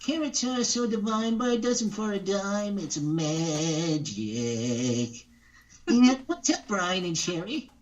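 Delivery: a wisecracking rabbit greeting; Bugs Bunny. Retold or imitated. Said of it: "Can you do Bugs Bunny".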